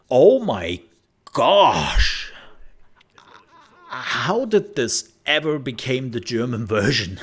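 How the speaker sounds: loud, high burst of disbelief; shocked exclamation. Retold or imitated. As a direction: blurt it out, stunned. Shocked at the bad translation.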